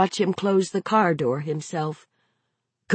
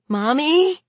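high, rising worried call; small child calling out in alarm; 9 semitones higher than usual. A narrator's reading. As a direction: ask it high, with alarm climbing through the word.

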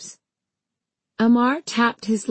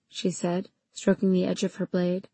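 usual speaking voice

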